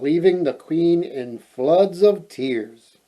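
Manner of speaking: ordinary delivery, nothing marked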